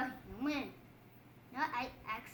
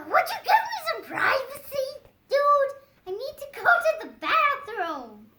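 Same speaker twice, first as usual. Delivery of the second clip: high, loud voice breaking into laughs; laughing plea; 12 semitones higher, 10 dB louder than usual. Acted. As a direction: beg for privacy through giggles. Character begging for privacy, laughing.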